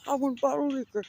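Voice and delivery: high-pitched mimicry of a demanding friend; mock demanding voice, high; mocking imitation.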